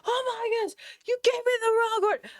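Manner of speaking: high, shocked, overdramatic mock complaint; mock dramatic outrage. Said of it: "I'm not gonna be like".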